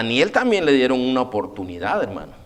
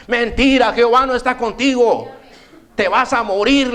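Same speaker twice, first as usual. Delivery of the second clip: higher, louder stern warning; prophet's rebuke; 7 semitones higher, 4 dB louder than usual. Retold or imitated. Prophet of God warning the king of death, loud stern call. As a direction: pronounce doom on the king. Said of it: "le dice".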